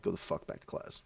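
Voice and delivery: hushed, soft retold dismissal; quiet, understated command; low-key order. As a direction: send him off under the breath. Same order retold, calmed down.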